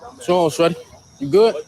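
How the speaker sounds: loud, confronting street tough; tough guy act. Pretend. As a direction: get in their face like a menacing stranger. Prank persona confronting stranger.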